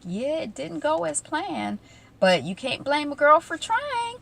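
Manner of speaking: slow start, lilting up high in mock innocence; imitating Veronica. Veronica excusing the failed plan, high airy imitation.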